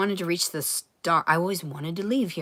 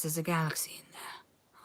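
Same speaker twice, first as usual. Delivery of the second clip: whisper; 12 dB quieter than usual. Retold or imitated. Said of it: "they used to tell me things like".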